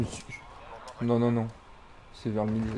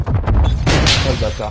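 loud frustrated curse; 13 dB louder than usual. Real, not acted. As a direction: spit it out loudly. Reacting to an enemy.

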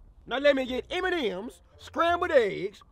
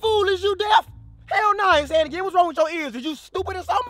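loud, higher insulting shout; angry yelling; 6 semitones higher, 5 dB louder than usual. Acted. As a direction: yell the insults down at him. Customer insults worker loudly.

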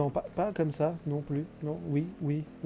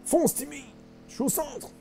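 squeaky put-on voice; 5 dB quieter, slower than usual. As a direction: play a squeaky little character. High put-on voice during play. (petite voix aiguë)

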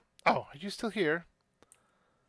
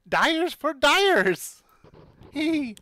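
tiny excited voice; 9 semitones higher, 8 dB louder, much slower than usual. Acted. Excited shopkeeper voice.

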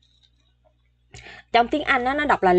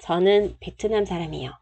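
fast recap; 2 semitones lower, 4 dB quieter, much faster than usual. Shown as a demonstration. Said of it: "quick final reading".

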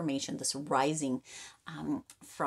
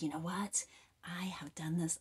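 whispered pride; 6 dB quieter than usual. Retold or imitated. Voicing the listener's pride.

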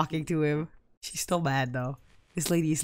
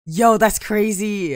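ordinary talk, nothing marked

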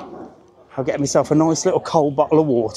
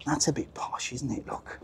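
hushed aside; 15 dB quieter than usual. Real, not acted. Hushed comment passing a posh bar.

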